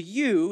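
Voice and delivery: "you" said high and loud; emphatic you. Shown as a demonstration. Preacher stressing you in verse four. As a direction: hit "you" hard. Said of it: "is emphatic".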